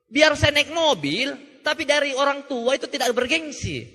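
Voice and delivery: ordinary talk, nothing marked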